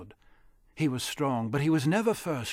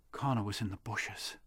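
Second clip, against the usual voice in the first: usual speaking voice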